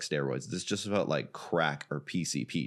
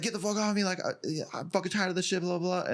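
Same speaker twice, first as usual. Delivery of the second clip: much higher, mock-angry rant; imitated freak-out; 11 semitones higher than usual. Coach retells opponent cursing him out, high mock freak-out. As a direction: play his ranting for laughs, pitched up. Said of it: "he starts freaking out".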